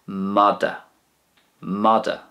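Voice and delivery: madra without the R; Connacht madra. Shown as a demonstration. Teacher models Connacht dog, madra losing its R. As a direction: drop the R, madra as mada. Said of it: "So you'd lose the R".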